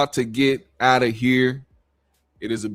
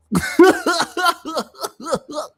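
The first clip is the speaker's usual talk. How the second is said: high put-on laugh; a hammed-up rising cackle in his voice; mock Vlad laugh. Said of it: "How, how does he laugh?".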